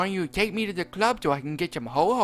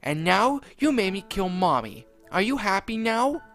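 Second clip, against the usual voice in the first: high, angry spat rant; Jeffy spitting it out; 7 semitones higher, 5 dB louder than usual. Acted. Jeffy blames Mario after killing Rosalina, high and angry. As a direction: spit the blame at him. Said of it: "Jeffy spat".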